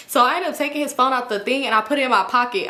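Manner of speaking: ordinary talk, nothing marked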